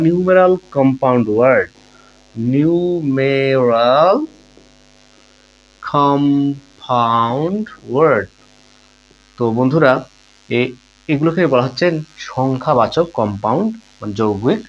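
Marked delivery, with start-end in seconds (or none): 2.5-8.3 very slow, drawn-out syllables with long pauses between words; slow dictation. Shown as a demonstration. Teacher spelling out a new term for students, slow dictation. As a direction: stretch out each word as if for note-taking.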